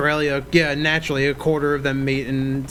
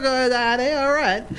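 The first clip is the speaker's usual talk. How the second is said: much higher, mock Cockney child voice; Cockney kid; 9 semitones higher than usual. Imitating British kid spotting a rat, Cockney accent. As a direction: mimic an amazed Cockney boy, pitched way up. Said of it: "British kid who had a Cockney accent".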